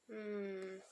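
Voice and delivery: low, soft "hmm"; thoughtful hum. A narrator's reading. Young ponders where the key is, thoughtful hum. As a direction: hum quietly while thinking it over. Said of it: "Young hummed, pulling a thinking face".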